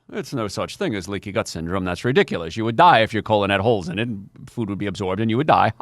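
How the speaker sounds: low, scoffing mock authority; pooh-pooh it like a stuffy skeptic; dismissive establishment. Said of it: "it was poo-pooed by the establishment".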